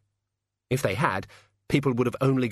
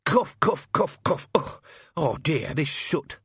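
high, exaggerated spoken coughs; hammy complaint about choking; loud fake coughing; 6 semitones higher, slower than usual. A narrator's reading. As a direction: make a show of coughing over them. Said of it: "said Albert loudly".

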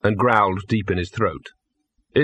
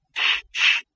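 hush. Acted. Hushing the dog.

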